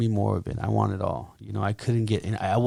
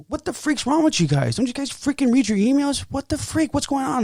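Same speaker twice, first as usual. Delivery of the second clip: very high-pitched mock outrage; exasperated, high-pitched imitation of a rant; retold indignant outburst. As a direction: re-enact telling the crew off, voice way up. Retells his outburst at coworkers.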